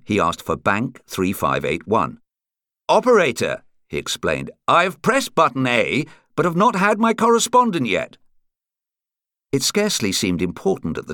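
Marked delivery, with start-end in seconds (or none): 2.9-3.6 raised, higher character voice; caller addressing operator. A narrator's reading. 4.7-8.2 higher-pitched man on the phone; telephone caller explaining. A narrator's reading.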